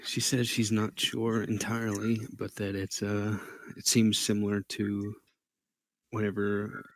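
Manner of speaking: usual speaking voice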